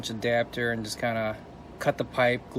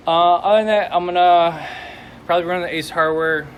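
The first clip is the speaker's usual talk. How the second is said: breathless, panting between words; out of breath; 5 semitones higher, 8 dB louder than usual. Real, not acted. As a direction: talk while still catching breath. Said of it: "sorry, I'm out of breath here".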